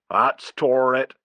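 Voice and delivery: slow glum rustic groan; gloomy. A narrator's reading. Father giving up on the tantrum, gloomy. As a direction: sigh it out gloomily. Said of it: "said Stimpson gloomily".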